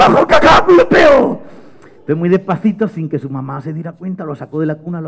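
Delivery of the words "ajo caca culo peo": put-on high, loud baby squawk; excited baby babble. Baby brother answering Mateo, loud high babble. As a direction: shout nonsense like a wide-awake baby. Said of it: "su hermano Pablete".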